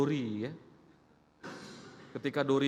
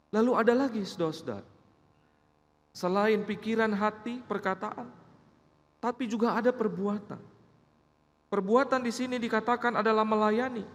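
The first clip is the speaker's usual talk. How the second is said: higher, louder pulpit preaching; preacher exhorting the church, full force; fervent preaching; 8 semitones higher, 4 dB louder than usual. Acted. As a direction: drive the point home to the congregation.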